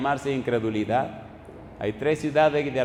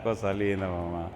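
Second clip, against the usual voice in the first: much softer and lower, tender and soothing; tender endearment; 8 semitones lower, 7 dB quieter than usual. Retold or imitated. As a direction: coo it gently, like calming a child. Pastor comforts crying young woman. (dulce y tierno, mucho más suave y grave)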